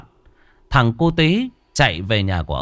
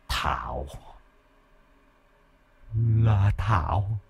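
muttering; 8 dB quieter, slower than usual. A narrator's reading. Demon remembers Thảo and mumbles her name, dazed muttering. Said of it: "miệng nó lẩm bẩm".

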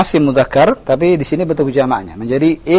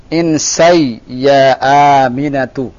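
very slow, drawn-out phrase for repeating; model reading; slower than usual. Shown as a demonstration. Teacher modelling for the student to repeat, slow model reading. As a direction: stretch the vowels, let it fall. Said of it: "kita baca sama-sama".

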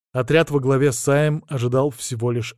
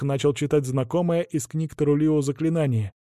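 ordinary delivery, nothing marked

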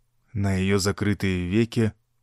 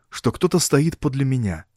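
plain reading voice

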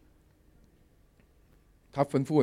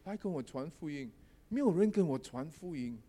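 softer repeat of the puppy's call; quiet retelling; 9 dB quieter than usual. Retold or imitated. Pastor quietly repeating what the puppy called, softer. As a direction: say it again quietly, half to yourself. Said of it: "一路这样喊哦".

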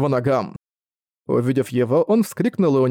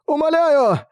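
even higher desperate cry; frantic pleading; 13 semitones higher, 3 dB louder, slower than usual. Acted. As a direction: beg at the top of the voice.

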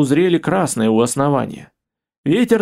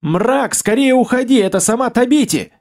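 yelling warning; 8 semitones higher than usual. Acted. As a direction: shout the warning across the distance. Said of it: "заорал".

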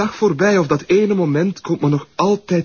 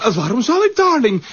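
mocking tease; 7 semitones higher than usual. Mocking blackmailer teases partner.

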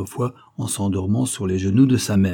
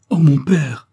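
higher and louder, a daughter's desperate plea; anguished cry; 7 semitones higher, 8 dB louder, slower than usual. A narrator's reading. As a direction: cry out to your father in distress. Daughter cries out in distress. (cri d'angoisse de la fille, plus aigu et plus fort)

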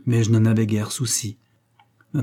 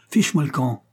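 higher-pitched, understated angry order; brutal cry; 5 semitones higher than usual. A narrator's reading. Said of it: "brutalement me cria". (cri brutal, joué avec retenue)

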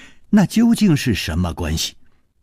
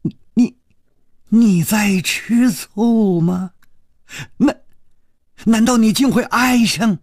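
stunned disbelief; 8 semitones higher than usual. Acted. Dying woman asks her rival in disbelief if she is jealous. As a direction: gasp out the questions in shock.

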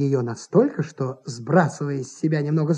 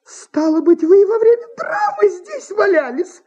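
high, loud, scandalised question; appalled actress, pitch leaping up; horrified; 5 dB louder than usual. A narrator's reading. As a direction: exclaim in horror as the actress. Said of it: "ужаснулась артистка".

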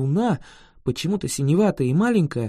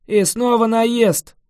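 sighing; 5 semitones higher, 5 dB louder, slower than usual. A narrator's reading. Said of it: "вздохнул дракон".

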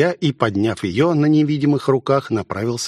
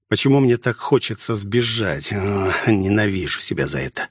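usual speaking voice

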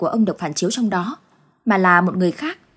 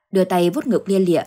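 ordinary delivery

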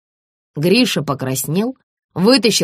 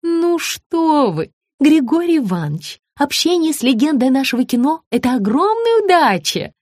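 fawning; 9 semitones higher than usual. A narrator's reading. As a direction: lay the flattery on thick. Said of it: "залебезила Богданова".

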